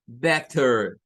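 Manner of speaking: "better" with a crisp T sound; American with clear T. Shown as a demonstration. Teacher showing the T in American "better". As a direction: say "better" with a clean T in the middle. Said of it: "con el sonido T".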